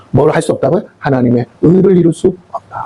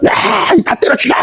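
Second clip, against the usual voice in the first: acted wrath; 12 semitones higher than usual. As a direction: act out an outraged command, pitched high. Acts out God's wrath.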